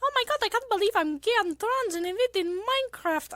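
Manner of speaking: warming up into a Vocaloid squeak; [name] voice attempt. Streamer trying to find her [name] voice, high. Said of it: "I'm trying to get my [name] voice".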